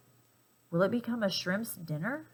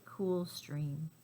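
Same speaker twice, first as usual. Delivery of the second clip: whispered; 2 semitones lower, 7 dB quieter than usual. Acted. Reading to child, hushed ending.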